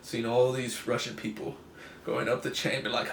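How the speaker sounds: usual speaking voice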